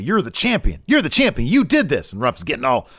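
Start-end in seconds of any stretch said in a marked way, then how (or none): 0.9-2.1 high, louder hammed-up conviction; imitated pep talk. Show host urging the meek champion on, imitated fired-up conviction. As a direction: mimic someone insisting with rising conviction. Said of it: "have a little bit more conviction".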